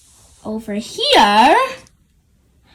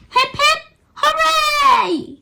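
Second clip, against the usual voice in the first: cheering; 4 dB louder, slower than usual. A narrator's reading. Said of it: "shouts the children".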